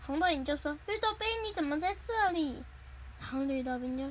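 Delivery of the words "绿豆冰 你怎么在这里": high, light questioning voice; surprised story character. Acted. Older sister finds 绿豆冰 in rain, surprised. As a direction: ask it pitched up, surprised.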